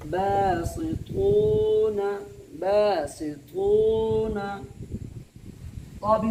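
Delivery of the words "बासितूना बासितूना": बासितूना slow and loud, every madd held; loud drawn-out recitation. Shown as a demonstration. Teacher recites बासितूना for the student to repeat, slow and loud. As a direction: sing out बासितूना slowly, holding the long vowels. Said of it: "समझ में आ गई बात कैसे पढ़ना है?".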